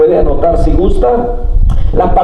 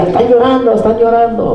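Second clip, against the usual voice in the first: sing-song mocking "Están llorando", pitched higher; teasing daughter; 5 semitones higher, faster than usual. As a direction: poke fun like a child teasing her parents. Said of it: "Haciéndonos bullying".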